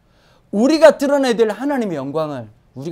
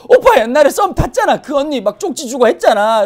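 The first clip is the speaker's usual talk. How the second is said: high, loud teasing chatter; excited gossip; 6 semitones higher, 7 dB louder than usual. Acted. Relative teasing a brother about his old crush, excited gossip. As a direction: pipe up with juicy old gossip.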